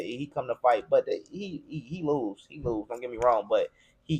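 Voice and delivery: ordinary talk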